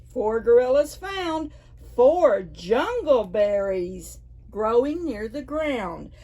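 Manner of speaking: usual speaking voice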